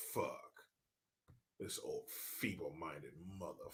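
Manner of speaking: very low, barely audible grumble; muttered disgust. Real, not acted. Host despairs at the feeble old man, muttering. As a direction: grumble it under your breath.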